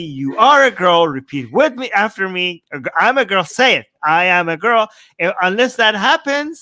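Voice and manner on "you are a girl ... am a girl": mock lecturing adult. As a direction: spell it out like a pushy parent. Mocking adult dictating identity to child.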